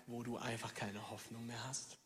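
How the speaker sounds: ordinary talk, nothing marked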